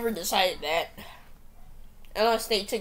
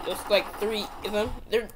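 normal talking voice

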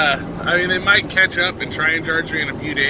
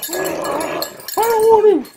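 very high, slow pup whine; stubborn dog voice; much slower than usual. Pretend.

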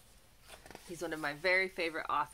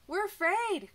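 much higher, louder scared cry; frightened fish; 12 semitones higher, 7 dB louder than usual. Acted. Fish crying to the rooster in the dark, high and scared. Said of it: "cried the fish".

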